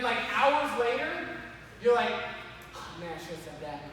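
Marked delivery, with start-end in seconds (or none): 2.7-3.8 low, hushed grumble to yourself; muttered regret. Acted. Kicking yourself hours after the argument, muttered low. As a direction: mutter it under your breath.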